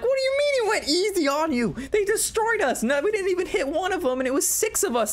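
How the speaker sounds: very high, whiny protest; squeaky complaint. Retold or imitated. Mocks the losing team complaining to the ref in high whine. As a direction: whine like sore losers.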